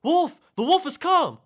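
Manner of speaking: high, piercing cry of alarm; yelling at full volume. A narrator's reading. Shepherd boy seeing a real wolf attack, full-out high yell. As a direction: yell for help at the top of your lungs. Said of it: "yelled at the top of his lungs".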